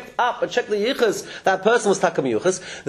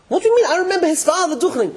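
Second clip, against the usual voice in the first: indignant protest; 9 semitones higher, faster than usual. Acted. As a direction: protest as if your word is being doubted. Acted indignant reply of a witness.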